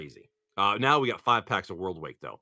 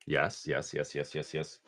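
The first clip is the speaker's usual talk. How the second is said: low repeated yes; 8 semitones lower, 8 dB quieter, slower than usual. Acted. Low rapid yeses.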